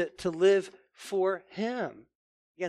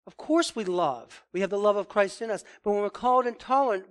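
ordinary talk, nothing marked

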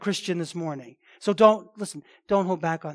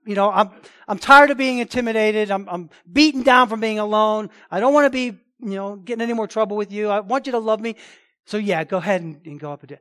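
a loud, hammed-up version of a beaten-down prophet giving in; loud mock surrender, as if he'd given in; caving prophet; 3 semitones higher, 8 dB louder than usual. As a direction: play Micaiah as worn out and pleading, pushed loud. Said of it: "Is that what he's saying?".

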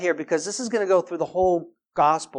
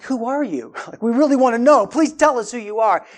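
higher, eager-to-know plea; earnest, pitched-up question; sincere eager inquiry; 5 semitones higher, 6 dB louder than usual. Shown as a demonstration. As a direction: ask as if you really want to know. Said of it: "they weren't asking in a way that says, like".

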